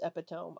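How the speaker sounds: epitome said as EP-ih-tome; epitome in three syllables, ending like home; childhood mispronunciation. Shown as a demonstration. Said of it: "I thought it was pronounced".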